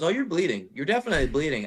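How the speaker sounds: high-pitched imitated reply; tattooist's mock assurance. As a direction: confirm it in a lifted, teasing voice. Tattooist's answer acted out.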